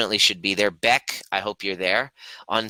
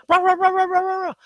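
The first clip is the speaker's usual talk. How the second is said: high, loud rapid-fire yapping; shrill string of mock barks; imitated dog barking; 5 dB louder, faster than usual. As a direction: bark like an excited dog. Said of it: "She starts barking".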